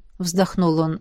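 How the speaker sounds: usual speaking voice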